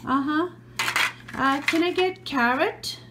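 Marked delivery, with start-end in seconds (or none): none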